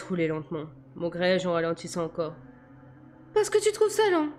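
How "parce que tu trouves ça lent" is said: high-pitched incredulous woman; outraged female passenger; indignant protest. A narrator's reading. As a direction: challenge him in a high voice. Bella protests at the driver's speed.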